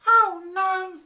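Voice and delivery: high-pitched loud wife's cry of dismay. A narrator's reading. Angry wife catching her husband working late, loud high cry. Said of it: "she cried".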